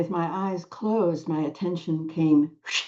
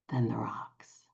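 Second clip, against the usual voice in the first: sudden soft whisper, lower; hushed, low aside; 6 semitones lower, 8 dB quieter than usual. Real, not acted. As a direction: let the line vanish into a whisper, lower. Trailing end of the claim.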